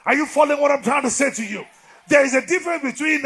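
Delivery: loud, high preaching cry; full-force preaching. Acted. Preacher roaring at his congregation. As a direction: preach it out over the crowd.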